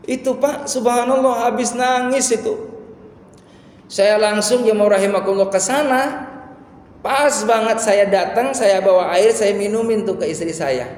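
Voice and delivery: high, loud sermon storytelling; impassioned preaching. Real, not acted. Preacher raises his story to full force.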